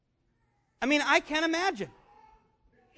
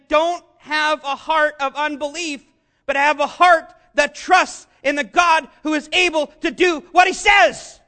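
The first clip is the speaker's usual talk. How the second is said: builds from slow and firm to a full shout; rising preached exhortation; 3 semitones higher, 7 dB louder than usual. Acted. Pastor urging the congregation, climbing to a shout. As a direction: preach it harder until you're shouting by the end.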